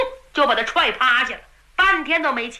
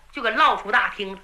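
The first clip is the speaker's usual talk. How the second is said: usual speaking voice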